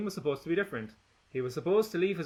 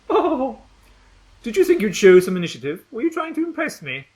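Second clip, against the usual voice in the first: mocking scorn; 9 semitones higher, 10 dB louder than usual. Gretchen mocking Sam.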